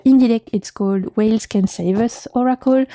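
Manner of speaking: usual speaking voice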